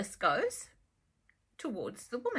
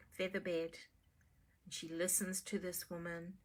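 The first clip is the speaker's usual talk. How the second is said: soothing story narration; 3 semitones lower than usual. A narrator's reading. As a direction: lull the listener gently.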